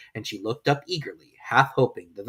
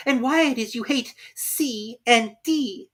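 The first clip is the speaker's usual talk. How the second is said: usual speaking voice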